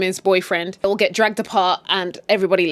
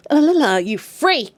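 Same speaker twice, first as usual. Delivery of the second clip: high, mocking singsong taunt; 6 semitones higher, 4 dB louder than usual. [name] laughs off the upset girl, taunting. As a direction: tease with a la-la-la jeer.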